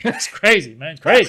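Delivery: loud, high, laughing disbelief; hammed-up marvelling; retold amazement. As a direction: act out a friend blown away. Retells friend marvelling at Tony's notes.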